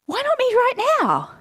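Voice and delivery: lifted, hopeful inner voice; bright self-encouraging ask. Pretend. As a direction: ask it like a sudden realization. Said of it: "and just go".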